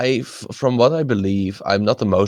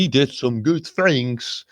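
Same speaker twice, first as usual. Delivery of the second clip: mock Scandinavian accent; 4 semitones higher, slower than usual. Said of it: "It sounded a little Scandinavian".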